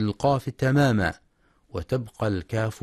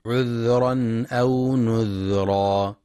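slow, drawn-out chanted Quran verse; Quran recitation; slower than usual. Shown as a demonstration. Teacher chanting a verse for tajweed students, slow and melodic. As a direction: chant the verse with tajweed, stretching each vowel. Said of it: "ينتبه القارئ لسكون الذال".

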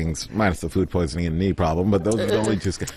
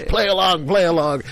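high, conspiratorial egging-on; imitated urging; 10 semitones higher, 4 dB louder than usual. Customer pulling Mehmet into the act, high eager urging. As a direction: urge him on high, acting it out.

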